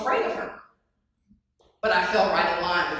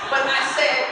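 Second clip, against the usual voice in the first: ordinary talk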